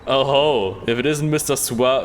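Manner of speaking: ordinary delivery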